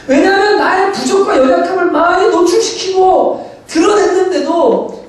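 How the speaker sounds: high, insistent sermon delivery; impassioned preaching. Acted. Pastor urging his church to embrace the weak, impassioned preaching.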